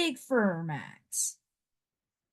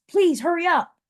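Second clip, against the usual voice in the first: higher, louder hurried plea; urgent plea; 8 semitones higher, 6 dB louder than usual. A narrator's reading. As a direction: hurry him along, eagerly.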